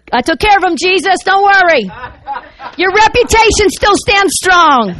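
high-pitched mock-heroic boast, slowing at the end; triumphant. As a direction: proclaim victory like a self-appointed champion. Mock-boasting to Jesus about his defence.

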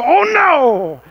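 high, loud alarmed cry; mock panic. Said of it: "you go".

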